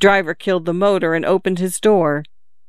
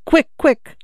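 high, urgent prompting; two quick high urgent jabs; 9 semitones higher than usual. A narrator's reading. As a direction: hurry someone along, pitched high. Urgently asking for the net.

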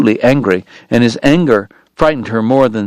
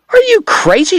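much higher, outraged woman's cry; Kate crying out. Acted. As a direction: shriek at him in shock. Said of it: "she cried".

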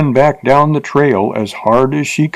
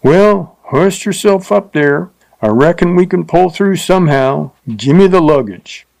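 higher, leering coarse frontier voice; leering rider; 6 semitones higher than usual. A narrator's reading. Rider leers at the stuck-up teacher, coarse voice. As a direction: sneer it like a crude drifter.

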